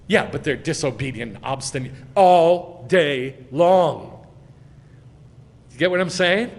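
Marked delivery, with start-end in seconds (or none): 2.1-4.3 slow, loud, separated words; emphatic, word by word. Shown as a demonstration. Preacher answering that they're disobedient, emphatic word by word. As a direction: land hard on each word, drawing it out. Answers objection with hammered phrase.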